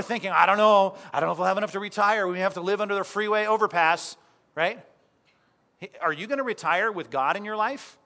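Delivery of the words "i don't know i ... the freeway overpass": louder, faster anxious fretting; worried fretting. Retold or imitated. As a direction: rattle off money fears in a panic. Voicing listener's money worry.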